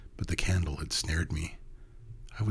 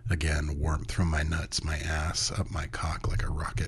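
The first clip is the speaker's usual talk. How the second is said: ordinary delivery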